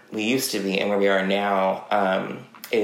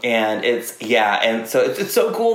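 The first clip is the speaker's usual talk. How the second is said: normal talking voice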